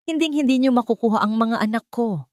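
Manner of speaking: loud, high defiant cry; a mother ready to kill for her kids; fierce shout. A narrator's reading. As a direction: protective mother yelling at gunman. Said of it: "Ang sigaw ni Stella".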